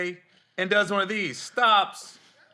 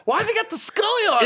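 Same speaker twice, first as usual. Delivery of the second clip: high puzzled question; 13 semitones higher, faster than usual. Retold or imitated. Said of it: "I go".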